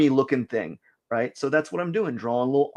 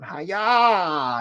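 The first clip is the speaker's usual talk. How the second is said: mock stabbing cry with a laugh; karate yell; 4 semitones higher than usual. Voicing the stabbing figure with a laughing "Hi-ya". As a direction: yelp it like a clumsy fighter. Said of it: "kinda going".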